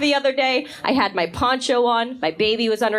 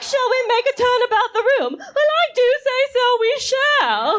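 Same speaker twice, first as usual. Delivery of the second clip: much higher, fast prim English lady voice; prissy high Regency voice, laughing; posh period lady; 12 semitones higher, faster than usual. Retold or imitated. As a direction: ham up a Jane Austen lady taking tea. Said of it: "They're like".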